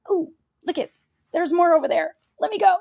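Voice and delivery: high-pitched, playful temptation; mock eagerness.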